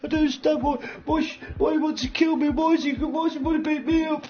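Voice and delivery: high whiny mock voice of a scared man; imitating Willie. Mocking Willie's panicked call from Madrid, high and whiny. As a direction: squeak it out like a frightened wimp. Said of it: "The man is in, in Madrid going".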